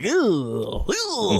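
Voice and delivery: disgusted wince. Retold or imitated. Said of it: "you're like".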